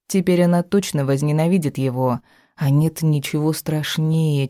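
plain reading voice

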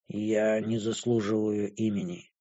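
low, quiet, detached character voice; indifferent. A narrator's reading. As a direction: say it softly, with no interest at all. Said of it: "проговорила она равнодушным тоном".

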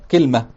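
كلمة said as kalma, two syllables, open a first; teacher demonstrating كلمة as kalma; Tamimi kalma. Shown as a demonstration. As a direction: say كلمة as kal-ma, no middle vowel. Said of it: "وهي لغة تميم".

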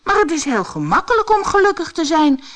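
high, loud, quick storybook cry; brisk, high-pitched cheering-up; eager exclamation. A narrator's reading. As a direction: call it out with bouncy encouragement. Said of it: "riep Brammetje Braam".

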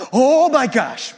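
loud, high, drawn-out panicked cry; hammed-up panic at a shaking plane; acted panic. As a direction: blurt it out in exaggerated alarm.

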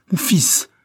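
high, loud cry of a frightened father; father's startled outcry; alarmed cry. A narrator's reading. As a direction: cry out to your son in alarm. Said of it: "s'écria-t-il".

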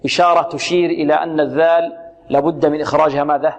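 ordinary talk, nothing marked

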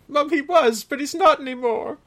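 high, loud hammed-up cry; an overplayed tearful outburst; loud mock anguish. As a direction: belt it out in mock grief. Quoting an emotional movie line.

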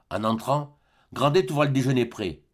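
ordinary delivery

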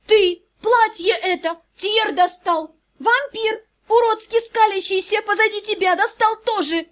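high, exasperated rant; angry yelling. A narrator's reading. Vampire daughter venting over the dress, angry yelling. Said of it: "Заэль орала".

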